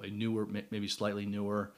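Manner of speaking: normal talking voice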